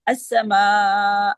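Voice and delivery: अस्समाअ slowly with a long closing आ; stretched madd on stop. Shown as a demonstration. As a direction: draw out the आ in अस्समाअ. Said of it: "ये मद्दे बदल की sound आ रही है". (अस्समाअ, लंबे आ के साथ)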